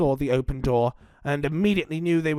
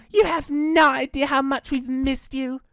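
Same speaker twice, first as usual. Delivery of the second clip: put-on higher child's voice; affectionate little joey; 7 semitones higher than usual. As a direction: tell mum in a pitched-up child's voice. Jenny greets returning mum.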